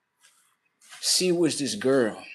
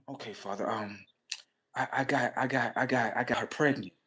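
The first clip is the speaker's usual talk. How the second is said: much softer, halting admission; nervous confession; 3 semitones lower, 9 dB quieter than usual. Retold or imitated. Son confessing the pregnancy to his father, soft and halting. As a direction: stammer it out quietly, afraid.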